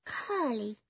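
put-on high little-boy voice; small boy. A narrator's reading. Small boy names his piglet, small child's voice. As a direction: answer as a tiny child. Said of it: "said the small boy".

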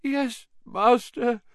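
very high, slow whimper; pitiful servant's high wail; pained moan. Acted. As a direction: moan it submissively to your master. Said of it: "moaned Wormtail".

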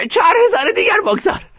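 put-on high, loud excited old woman; eager grandmother's order. A narrator's reading. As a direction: press the next bet.

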